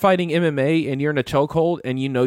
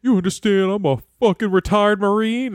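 higher-pitched imitation of a blustering Marine; mock indignant challenge; 7 semitones higher, 3 dB louder than usual. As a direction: puff up and challenge him in imitation. Retells an angry man's challenge.

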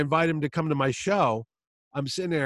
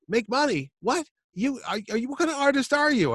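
incredulous Chaykin; 11 semitones higher than usual. Retold or imitated. Chaykin scolding the fan.

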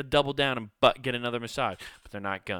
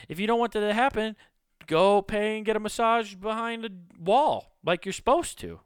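much higher and louder, lecturing; high preachy scold; mock scolding; 11 semitones higher, 4 dB louder than usual. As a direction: wag a finger at the mall massage crowd. Scolding massage-goers.

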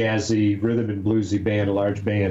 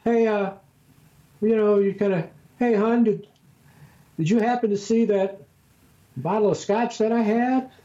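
very high, sweetly innocent question; fake-innocent squeak to a spouse; 11 semitones higher than usual. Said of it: "your voice goes high when you".

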